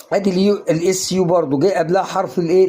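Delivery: ordinary talk, nothing marked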